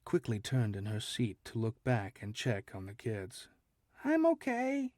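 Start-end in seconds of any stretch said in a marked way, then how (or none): 4.0-4.9 put-on high, small shaky kid voice; a scared little child answering. A narrator's reading. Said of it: "came a shaky voice".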